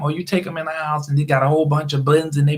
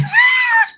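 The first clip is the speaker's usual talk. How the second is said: shrill burst of laughter, much higher; high squealing laugh; 5 dB louder than usual. Real, not acted. Laughing at himself on the livestream, a high squeal. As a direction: squeal with delight at yourself. Laughing after his own shout.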